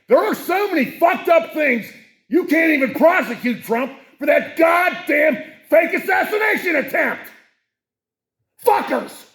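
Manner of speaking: high, loud enraged yell; angry shout. Real, not acted. Host rages about Trump not being prosecuted, furious shout. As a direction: boil over and shout it out. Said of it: "I'm sorry again for losing my temper".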